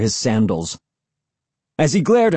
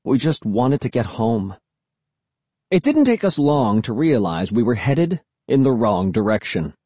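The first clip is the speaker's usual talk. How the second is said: ordinary delivery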